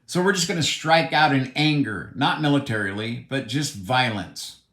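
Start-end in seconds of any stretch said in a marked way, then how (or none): none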